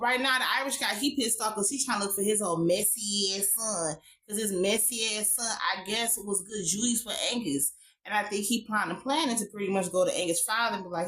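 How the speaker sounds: normal talking voice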